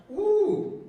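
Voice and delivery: high startled ooh; a high, surprised little gasp of a word. Retold or imitated. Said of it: "And I said".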